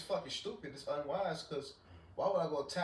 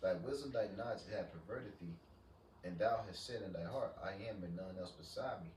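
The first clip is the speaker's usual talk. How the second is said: scripture reading; 3 semitones lower, 6 dB quieter than usual. Acted. Reads Isaiah aloud.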